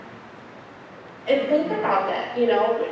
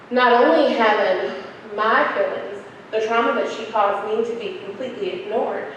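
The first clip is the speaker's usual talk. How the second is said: ordinary talk, nothing marked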